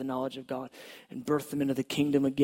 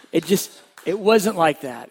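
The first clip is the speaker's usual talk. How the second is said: emphatic; 4 semitones higher, 11 dB louder than usual. Real, not acted. Emphatic denial in testimony.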